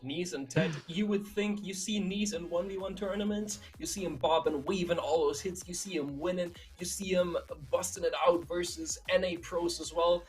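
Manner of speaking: normal talking voice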